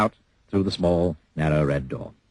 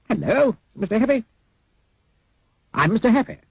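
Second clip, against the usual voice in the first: high, bright greeting; cheerful Mister Happy; 14 semitones higher than usual. Acted. Mister Happy greets his look-alike in a high cheery voice. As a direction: chirp it happily.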